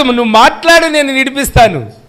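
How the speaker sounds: Pilate urging. Acted. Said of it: "అన్నాడురా, ఎవరన్నారు".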